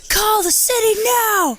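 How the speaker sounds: high, piercing imitation scream; mock scream call. Acting out his frantic order about the tree, high mock scream. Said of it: "That is scream call".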